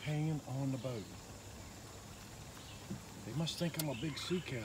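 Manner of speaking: normal talking voice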